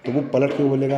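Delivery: ordinary delivery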